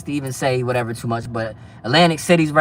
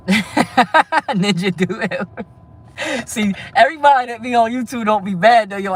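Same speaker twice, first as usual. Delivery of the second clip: laughing; 7 semitones higher than usual. Real, not acted. Laughing at a viewer comment.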